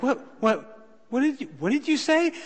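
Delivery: high, puzzled what-did-you-say; confused asking. Retold or imitated. Minister asking the lady to repeat, high and puzzled. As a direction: play the half-deaf man asking her to repeat. Hard-of-hearing minister asks.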